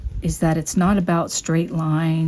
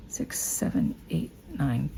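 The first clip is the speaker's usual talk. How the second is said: whispered counting; 5 semitones lower, 9 dB quieter, slower than usual. Real, not acted. Counting stitches under breath.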